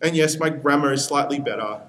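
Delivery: ordinary talk, nothing marked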